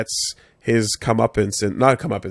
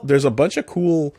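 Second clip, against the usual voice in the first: usual speaking voice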